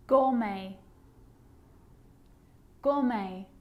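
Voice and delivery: "Gourmet" as GOR-may, no final T; careful model of "Gourmet", ending on ay; silent T. Shown as a demonstration. Teacher showing the sneaky T, careful repeated model. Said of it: "it can also be silent".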